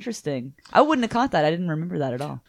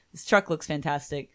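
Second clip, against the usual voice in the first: normal talking voice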